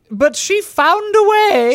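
slow, high theatrical delivery; milk it like a stage ham; hammy stage actor. Acted. Character line.

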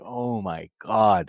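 slow, drawn-out shock; stunned disbelief. Retold or imitated. Viewer reacting to everything that happens in the show, stunned. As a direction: stretch each word out in disbelief.

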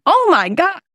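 high-pitched mock squeal of excitement; over-excited gushing. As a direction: gush like a thrilled parent. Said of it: "I didn't want to act like".

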